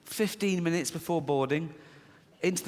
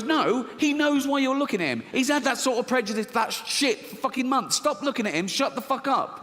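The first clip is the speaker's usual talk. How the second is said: scolding inner voice; 7 semitones higher than usual. Retold or imitated. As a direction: snap at yourself to stop staring. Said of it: "This one goes".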